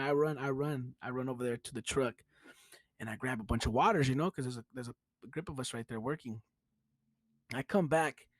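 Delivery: usual speaking voice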